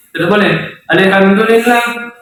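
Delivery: normal talking voice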